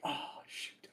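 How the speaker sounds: breathy whispered aside; whispering. Retold or imitated. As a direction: whisper it to yourself. Retelling own inner reaction.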